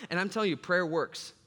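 ordinary talk, nothing marked

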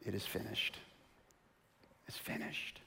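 barely audible last breath, fading to a whisper; dying whisper; faint dying words. Acted. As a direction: let it leave you like a final breath.

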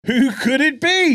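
high, loud mock-suspense question; playful cliffhanger call; teasing announcer. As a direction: pitch it up like a big reveal. Teasing next week's announcement.

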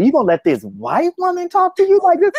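high, indignant mock scolding; high-pitched retold scolding of a friend; mother scolding friend. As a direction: squeak it out like an outraged mom.

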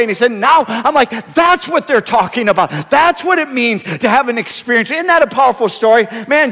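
very loud, high, impassioned preaching; full-force preaching. Real, not acted. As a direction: build to a shouted, emphatic sermon climax.

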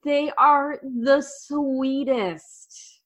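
high, drawn-out adoring coo; gushing affection. Real, not acted. Host adoring the young contestants, gushing slowly in a high voice. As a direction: savour every word, doting.

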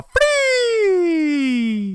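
very high, stretched mock announcer cry; imitated arena announcer. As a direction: squeal the word out like a hyped announcer. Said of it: "det enda han sa var ju".

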